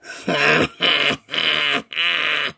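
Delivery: low eerie ghost laugh; spooky low chuckle; creepy laugh. Acted. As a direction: cackle low from the dark. Skeleton laughs in the dark.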